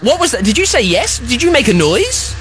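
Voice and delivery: ordinary delivery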